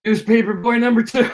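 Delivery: high, loud squeak through laughter; overawed bit player. Acted. Speaker answers the star, squeaky and giddy. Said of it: "And I goes".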